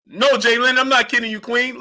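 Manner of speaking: normal talking voice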